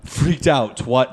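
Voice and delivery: usual speaking voice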